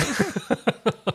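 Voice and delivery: amused high-pitched laugh. Real, not acted. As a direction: laugh at your own joke. Laughs at own quote.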